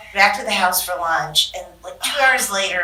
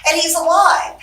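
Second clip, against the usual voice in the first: higher, louder, rushed and gleeful; excited; 10 semitones higher, 11 dB louder, faster than usual. Retold or imitated. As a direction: blurt out the big news. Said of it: "I said".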